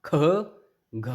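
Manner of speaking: ख, घ said with a strong puff of breath; teacher showing the aspirated ख and घ; aspirated demo. Shown as a demonstration. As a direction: push the breath hard on ख and घ. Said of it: "जोर लगता है काफ़ी ज़्यादा".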